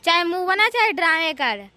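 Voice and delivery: very loud scolding; angry outburst. Acted. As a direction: lose your temper and lay down the law. Elder bahu loses temper in quarrel. (भड़ककर ज़ोर से डाँटना)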